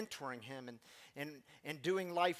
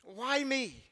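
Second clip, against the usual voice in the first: higher and louder, overwhelmed with thanks; grateful young man; 6 semitones higher, 6 dB louder than usual. Retold or imitated. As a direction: ask it in disbelief at such kindness. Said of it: "he says".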